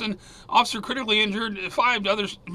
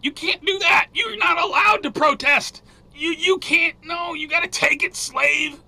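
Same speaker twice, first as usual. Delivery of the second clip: high, loud, mocking bark at a protester; mock scolding authority; 7 semitones higher, 4 dB louder than usual. Mocking the British police who jail a protester, scolding shout. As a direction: sneer it down like a bullying official.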